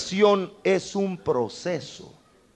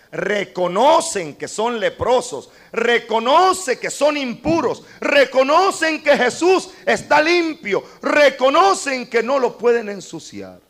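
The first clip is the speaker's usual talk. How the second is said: climbing louder and higher with each line; building preaching; 9 semitones higher, 4 dB louder than usual. Acted. Preacher stacking up what the lepers recognise, climbing loud. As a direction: build the list to a peak.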